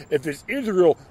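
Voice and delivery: usual speaking voice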